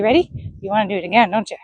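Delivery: usual speaking voice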